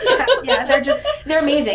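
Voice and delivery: high, loud laughing yeahs. Real, not acted. Surrogate laughing with the host while fighting tears, high and loud. Emotional moment, laughing together.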